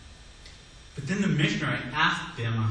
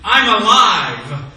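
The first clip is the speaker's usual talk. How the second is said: put-on loud, higher, slow joyful cry of a boy; joyful shout; 5 semitones higher, 10 dB louder, slower than usual. Boy bursting out of the river, shouting with joy. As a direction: shout it out like a boy just pulled from the water. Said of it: "the child came up out of the ceremony shouting".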